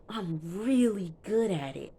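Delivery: REALLY GOOD leaned on hard; showy stress on "really good"; imitated boast. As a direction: punch up "really good" as a mimicked brag. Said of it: "The way he said that".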